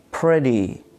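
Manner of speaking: "pretty" ending in a long ee sound; teacher's model "pretty", clear ee ending; final Y as E. Shown as a demonstration. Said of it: "pronounces like E".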